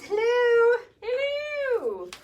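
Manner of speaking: put-on high, drawn-out British-accented hello; queenly British accent. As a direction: trill a hello like British royalty. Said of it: "said it with that accent".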